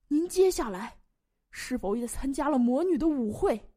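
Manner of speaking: worked-up bishop cross-examining; excited interrogation. Acted. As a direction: press the witness forcefully. Bishop eagerly interrogates the knight.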